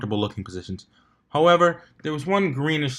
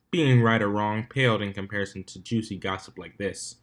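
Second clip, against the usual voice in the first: ordinary delivery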